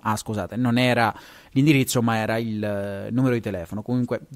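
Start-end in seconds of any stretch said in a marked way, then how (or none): none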